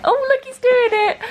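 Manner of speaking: high-pitched, excited squeal of delight; delighted cooing. Real, not acted. Mum spotting her baby crawling, high delighted cooing.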